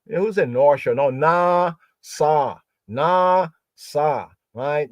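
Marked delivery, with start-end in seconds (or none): 0.9-4.3 "na, sa" in separate, held syllables with open ah; "na, sa" spaced out, each ending on a clean ah; no W glide. Shown as a demonstration. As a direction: break it into "na", "sa", pure ah vowels. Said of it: "No W glide at the end of it".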